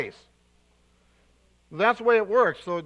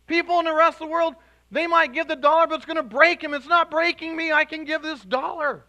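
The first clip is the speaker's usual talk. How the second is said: high, louder, fast; excited preaching; 8 semitones higher, 6 dB louder than usual. Real, not acted. Preacher rousing the crowd, high and loud. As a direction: build the joy, pushing up.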